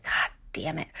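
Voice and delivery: very soft curse, whispered under the breath; hushed, unvoiced frustration. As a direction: curse it quietly so nobody hears. Losing the table she wanted.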